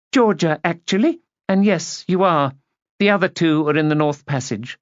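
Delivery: a touch of youthful defiance; lightly voiced rebellious girl. A narrator's reading. Young hostess correcting her aunt, mildly defiant.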